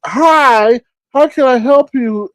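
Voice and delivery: mocking the clerk's sweet greeting, high and slow; friendly clerk. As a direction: put on an extra-polite shop voice. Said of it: "And he's like".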